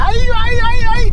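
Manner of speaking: high, loud hey hey hey; calling out. Real, not acted. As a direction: shout to get the driver's attention. Calling out at another car.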